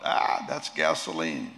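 low, slow mock cry of disgust; mild cry out. Doctor Young tasting the medicine, a low, slow cry. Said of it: "And Doctor Young cries out".

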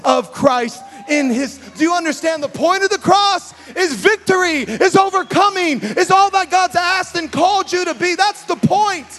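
loud, high impassioned sermon; fiery preaching. Acted. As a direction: preach it at full force. Preaching climax at full force.

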